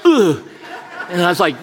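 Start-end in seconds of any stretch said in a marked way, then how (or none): 0.0-0.4 short high grunt, acted; mock "oof" of pain. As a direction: grunt as if struck in the back. Imitates being struck during massage.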